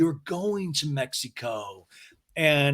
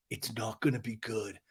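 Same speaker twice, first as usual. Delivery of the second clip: low, soft warning; Schwartz's quiet threat; 4 semitones lower, 10 dB quieter than usual. Retold or imitated. Recapper imitating Schwartz warning James, low and quiet. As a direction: drop down and murmur the warning.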